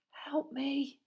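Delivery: high, helpless moan; moaned plea. Acted. Neville begs Hermione for help, moaning. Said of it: "he moaned to Hermione".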